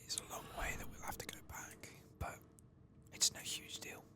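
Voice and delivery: hushed, unvoiced whisper; sneaky whisper. Player whispering to viewers while sneaking past Twilight Binders, hushed whisper. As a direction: whisper it as if creeping past someone.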